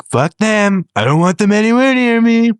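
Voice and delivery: mock president rant. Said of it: "He was like".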